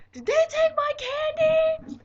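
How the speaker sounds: very high, loud, stretched-out demand; pissed-off shriek. Retold or imitated. Shouting to friends at the porch about stolen candy, furious shriek. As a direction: screech the question across the street. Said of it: "I'm gonna be so fucking pissed".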